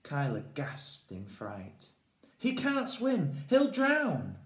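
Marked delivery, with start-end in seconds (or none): 2.4-4.4 high, loud slow panicked cry; frightened cry. Acted. Kyla crying out in fright about Finn, high and loud. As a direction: wail it in fright.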